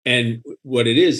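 ordinary delivery

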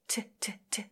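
T, T, T as voiceless puffs of air; whispered T. Shown as a demonstration. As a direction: breathe out short T bursts.

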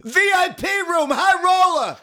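high, loud put-on announcer; high announcer shout; club announcer. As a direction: bark it like a sleazy club announcer. Club announcer calling the stripper.

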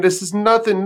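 ordinary talk, nothing marked

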